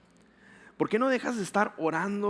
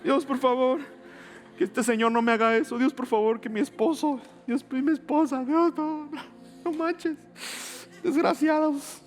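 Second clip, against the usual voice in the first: high, pinched mock begging; hammed-up tearful begging, much higher; desperate whiny plea; 8 semitones higher than usual. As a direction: whimper it like a panicked prayer. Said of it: "tú estás en tu cabeza llorando".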